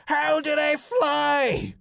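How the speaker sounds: very high, drawn-out yell; furious roar. Acted. Alien rounds on diplomat in rage, roaring. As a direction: roar it out, stretching every word. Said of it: "rounded on Gonzal and roared".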